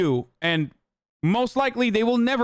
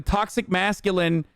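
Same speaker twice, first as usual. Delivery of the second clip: ordinary delivery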